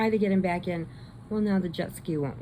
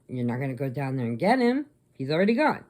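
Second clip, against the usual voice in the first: ordinary delivery, nothing marked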